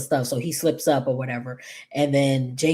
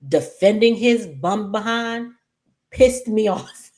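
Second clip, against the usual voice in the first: raised pitch, amused exasperation breaking into a laugh; irritated, half laughing; 5 semitones higher, 3 dB louder than usual. Real, not acted. Host venting about having to defend Tomas, irritated but laughing. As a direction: vent it, then crack up near the end. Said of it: "I was irritated off rip".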